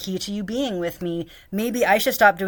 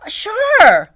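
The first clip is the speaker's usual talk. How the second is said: mock chirpy boss; 13 semitones higher, 11 dB louder than usual. Imitating boss.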